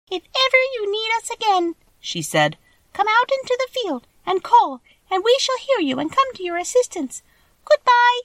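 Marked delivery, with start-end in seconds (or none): 0.1-1.7 very high, squeaky little queen voice; tiny mouse Queen. Acted. Mouse Queen answering the Scarecrow, high and squeaky. 2.9-8.2 a little mouse queen calling out her farewell; tiny mouse Queen. Acted.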